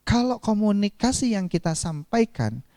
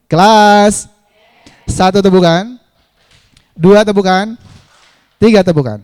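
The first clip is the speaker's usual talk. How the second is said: loud, projected clapping commands; attention call; 13 dB louder than usual. Real, not acted. Trainer gets the class to clap, loud commands. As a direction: call out to bring the room to order.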